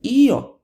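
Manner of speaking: "io" in two syllables, EE-oh; stressed i. Shown as a demonstration. Teacher asking what if, "io" with stressed EE. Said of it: "emphasis actually falls on that I".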